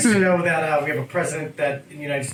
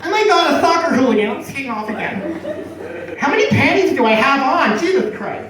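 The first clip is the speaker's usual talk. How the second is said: much higher, louder swoon; swooning girl; 8 semitones higher, 3 dB louder than usual. Retold or imitated. Comic higher squealing girl swooning over hooligan. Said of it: "girls would just be like".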